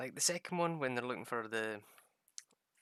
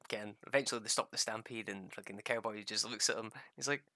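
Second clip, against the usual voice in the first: ordinary talk, nothing marked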